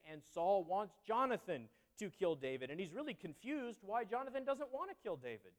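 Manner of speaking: ordinary talk, nothing marked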